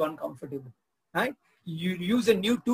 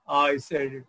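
low principal reply; 4 semitones lower than usual. Retold or imitated. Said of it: "the principal says".

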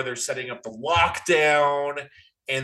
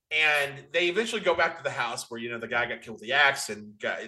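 ordinary talk

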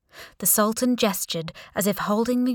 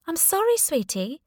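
high-pitched, sorry to disappoint; higher voice, consoling a patient; sympathetic nurse; 9 semitones higher than usual. A narrator's reading. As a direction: let the pitch leap up in apology. Nurse gently breaks bad news.

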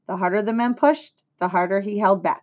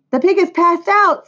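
loud, high-pitched announcement; alarmed cry; 11 semitones higher, 7 dB louder than usual. A narrator's reading. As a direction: narrator acting out a woman's alarm. Alarm as the pig faints.

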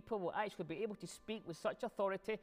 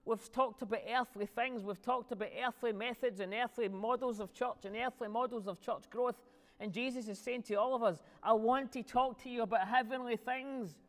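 raised, louder preaching voice, phrases rising high; impassioned preaching; 7 semitones higher, 6 dB louder than usual. Real, not acted. Preacher telling the church of heavenly things, impassioned. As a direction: preach it with building force.

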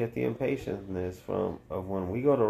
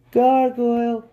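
high, loud shrieked yell; mom yelling; 13 semitones higher, 9 dB louder, slower than usual. Retold or imitated. Mom yelling at a waiter, high loud shriek. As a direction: yell it across the restaurant. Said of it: "my mom would yell".

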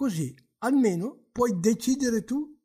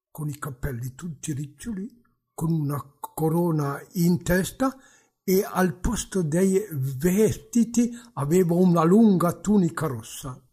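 ordinary delivery

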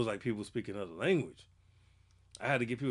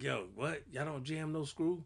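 southern drawl imitation; 4 semitones higher, 6 dB quieter, slower than usual. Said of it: "like [name] said".

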